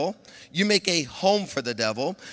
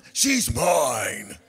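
lower, put-on demon voice; a hint of demon voice; demon claiming her; 6 semitones lower than usual. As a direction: growl it as the possessive demon. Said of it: "and the demon said".

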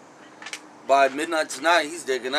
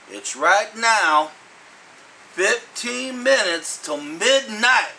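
slow dramatic; 5 semitones higher, much slower than usual. Acted. Dramatic countdown to midnight.

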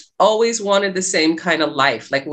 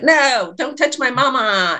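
imitating a toddler; 6 semitones higher than usual. Her small son pushing Anthony away, higher childish protest. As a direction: play the three-year-old guarding his mama. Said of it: "He was like".

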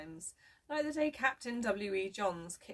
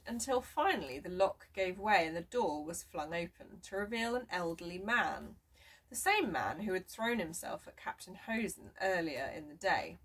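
ordinary delivery

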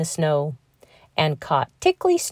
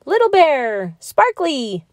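shouted; 8 semitones higher, 3 dB louder than usual. A narrator's reading. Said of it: "shouted Flinty Fox".

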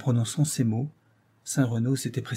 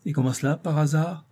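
higher, ironic question; 5 semitones higher than usual. A narrator's reading. Jealous lover questions the actress's threat, ironic. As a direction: question with mild sarcasm, pitched up. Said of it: "questionna Saint-Renaud ironique".

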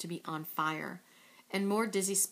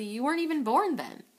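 quick; 8 semitones higher, 6 dB louder than usual. A narrator's reading. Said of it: "Sadako said quickly".

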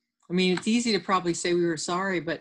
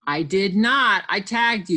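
mild storybook yell; raised, emphatic protest; 3 semitones higher, 6 dB louder than usual. Acted. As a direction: insist loudly in a squabble. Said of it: "yelled Fritz".

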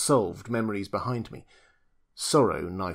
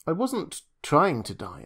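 higher, pushing back hard; protesting; 6 semitones higher than usual. Acted. Julius to the doctor, protesting. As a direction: insist you meant no such thing. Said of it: "I protested".

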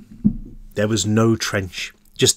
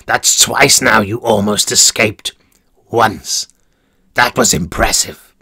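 sinister warden; 2 semitones lower than usual. Acted. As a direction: purr it like a cruel warden. Warden praises coldly.

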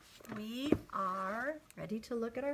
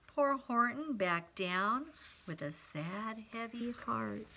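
put-on story read to children; 4 semitones higher than usual. A narrator's reading. Reads sad moment to children.

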